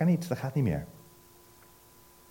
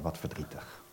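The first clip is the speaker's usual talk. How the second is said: sad sigh; 7 semitones lower, 14 dB quieter than usual. Acted. Preacher laments separation.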